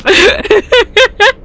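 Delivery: squealing laughter far up in pitch; helpless high laughter. Real, not acted. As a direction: lose it completely, laughing high. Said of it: "I can't stop laughing".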